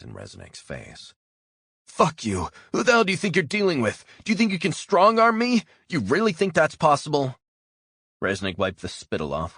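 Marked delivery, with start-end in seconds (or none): 1.9-7.4 high, loud, fast, raging yell; furious defiant shout. Acted. Bound prisoner screams defiance at his interrogator, furious shout. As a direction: spit the words at him in fury. Captive spits, defies his captor.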